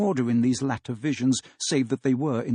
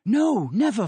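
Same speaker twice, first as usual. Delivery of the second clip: put-on higher, louder cry of the children; the children answering their father at once; 10 semitones higher, 4 dB louder than usual. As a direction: call out together in fervent denial. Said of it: "they all cried again".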